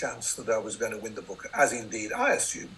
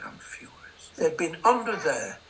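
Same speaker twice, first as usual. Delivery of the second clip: mother's mock fury; 7 semitones higher than usual. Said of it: "My mother said".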